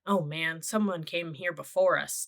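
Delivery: ordinary delivery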